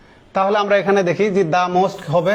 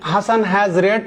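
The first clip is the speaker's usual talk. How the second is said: slow read-aloud; 2 semitones higher, much slower than usual. Shown as a demonstration. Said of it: "reads example sentence slowly".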